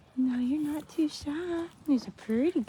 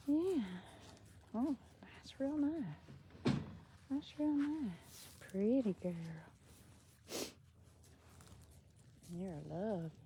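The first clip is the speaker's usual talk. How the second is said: much softer, slow cooing; tender coo; 4 semitones lower, 13 dB quieter, slower than usual. Real, not acted. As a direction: murmur it gently to a pet. Cooing softly to scratched hen.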